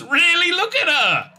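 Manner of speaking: high, disbelieving cry of really; can't believe what she's seeing; sharp exasperated disbelief; incredulous. Real, not acted. Disbelief at the screen.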